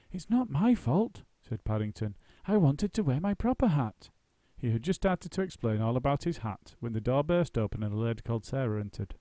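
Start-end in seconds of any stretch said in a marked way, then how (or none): 0.1-4.1 Paddington voice. A narrator's reading.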